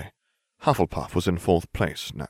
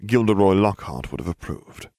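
plain reading voice

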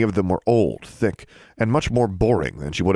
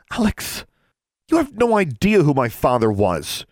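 snapping; 8 semitones higher than usual. A narrator's reading. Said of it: "Alexandra snapped".

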